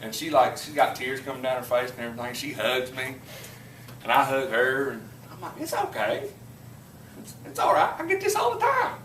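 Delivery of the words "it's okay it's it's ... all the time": very high, squeaky put-on voice; hammed-up weepy reassurance, pitched way up; mock tearful comforting. Student hugging the crying professor, mock tearful high-pitched comforting.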